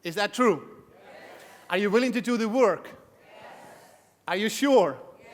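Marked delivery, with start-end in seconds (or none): none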